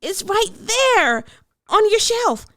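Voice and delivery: put-on sing-song child ordering lunch; eager little girl. A narrator's reading. Hannah voice continues.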